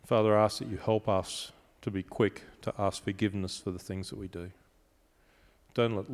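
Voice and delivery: soft, earnest prayer voice, hushed at the ends; closing prayer. Acted. Pastor praying for his congregation at the end, softly. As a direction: pray it gently over the congregation.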